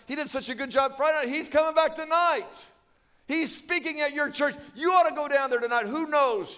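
high, building excitement; excited urging. Acted. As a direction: press your husband eagerly to go. Acted wife urges husband, building.

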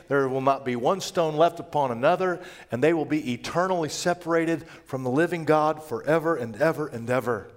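ordinary talk

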